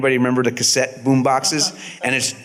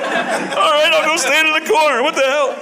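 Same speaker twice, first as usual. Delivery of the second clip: high, fast, laughing mock confusion; laughing disbelief. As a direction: giggle through a baffled reply. Baffled actor obeying odd order.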